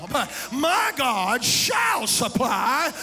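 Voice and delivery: loud, slow, crying-out preacher's climax; full-force preaching. Acted. Preacher crying out to his congregation, full force. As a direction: preach it with the organ behind you.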